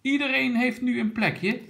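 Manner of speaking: high, cheerful grandpa line; happy. A narrator's reading. Grandpa sees everyone seated in the wagon, happy. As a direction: lift the pitch right up, pleased. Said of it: "En opa Brom zei blij".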